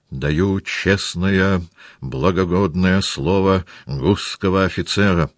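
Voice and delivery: slow, emphatic pledge with swallowed R's; Denisov's burr. A narrator's reading. Officer pledges his word to commander, burred R's. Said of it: "говорил Денисов".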